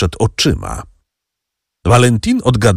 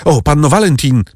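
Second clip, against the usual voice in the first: calling out; 7 semitones higher than usual. A narrator's reading. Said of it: "zawołał Franz".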